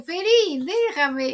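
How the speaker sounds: playfully imitated squabble; childish mock protest. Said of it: "parecen niños chicos".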